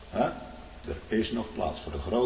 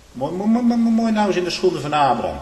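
higher and louder, rising with delight; overjoyed preacher savouring the story; rapt exclamation; 11 semitones higher, 9 dB louder than usual. Real, not acted. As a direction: marvel aloud in wonder. Preacher exults over Abraham's faith.

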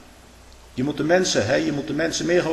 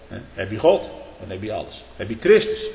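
ordinary talk, nothing marked

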